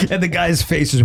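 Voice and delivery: normal talking voice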